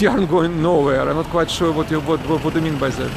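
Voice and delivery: usual speaking voice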